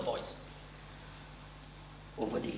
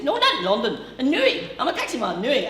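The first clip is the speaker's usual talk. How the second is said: put-on Newry accent; 9 semitones higher, 5 dB louder, faster than usual. Newry man voiced.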